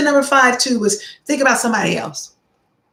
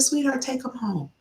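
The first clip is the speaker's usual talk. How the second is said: tender, generous; 4 semitones higher, 8 dB quieter than usual. Retold or imitated. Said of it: "I said".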